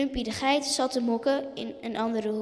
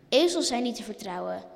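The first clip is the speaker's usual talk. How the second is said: ordinary delivery, nothing marked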